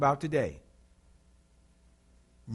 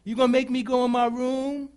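put-on higher, whiny childlike plea; lightly voiced pleading child; childish demons; 6 semitones higher than usual. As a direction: play the demons as whining kids. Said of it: "talked to them like children".

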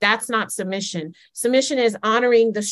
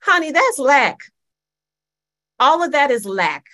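higher and louder, preacher's call; emphatic exclaim; 6 semitones higher, 5 dB louder than usual. Acted. Preacher calling out to congregation, loud and high. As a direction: hit it hard, honey.

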